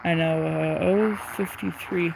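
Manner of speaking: ordinary talk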